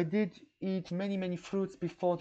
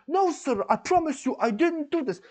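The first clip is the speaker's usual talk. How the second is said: high, louder pleading denial; mock gangster; 9 semitones higher, 5 dB louder than usual. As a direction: imitate a gangster swearing innocence. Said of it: "like a gangster".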